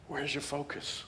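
quiet searching echo; soft repeat. Shown as a demonstration. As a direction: say it again, almost to yourself.

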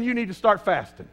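normal talking voice

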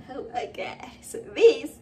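breathless whispered oh my God; awed whisper. Real, not acted. Vlogger awed as the machine starts, whispering. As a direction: hush it out in awe.

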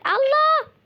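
loud high scream of fright; high loud shriek; frightened scream. Real, not acted. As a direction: shriek in terror. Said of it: "ben de senin gibi bağırıyormuş".

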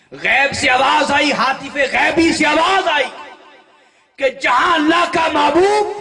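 preaching at full force. Acted. Preacher thundering to his congregation about the saint's glory. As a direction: proclaim it with full fervour to the crowd.

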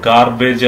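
ഗാർബേജ് as "gaar-BAYJ", r sounded; sounded r and long "-ayj" in ഗാർബേജ്; common mispronunciation. Shown as a demonstration. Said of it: "എന്നാണ് പറയാറുള്ളത്".

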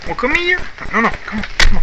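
high, sing-song call to a dog; high coaxing dog call. Real, not acted. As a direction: coax the puppy up in a high voice.

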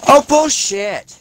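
high, slow, drawn-out yell; outraged. Real, not acted. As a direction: howl it in disbelief. Killed in game, outraged.

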